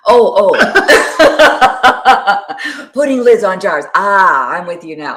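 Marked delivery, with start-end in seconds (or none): 0.1-2.9 real burst of laughter. Real, not acted. Laughing at the double meaning.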